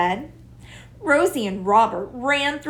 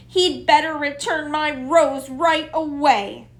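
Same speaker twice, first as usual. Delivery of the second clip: indignant; 5 semitones higher, 4 dB louder than usual. A narrator's reading. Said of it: "protested Rosie".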